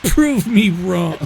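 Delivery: mock challenge. Demanding proof in banter.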